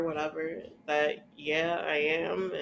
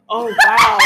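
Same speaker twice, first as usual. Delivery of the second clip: laughing mock surprise; 15 dB louder than usual. Imitating doctor's reaction, laughing.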